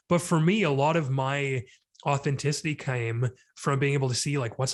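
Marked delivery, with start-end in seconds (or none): none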